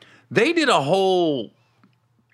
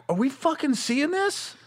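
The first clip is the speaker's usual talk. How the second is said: high, softer, drawn-out disbelief; mock stunned question, pitched up; retold shock; 9 semitones higher, 6 dB quieter, slower than usual. Van passengers shocked at the couple, high hushed disbelief. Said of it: "we were all in shock, like".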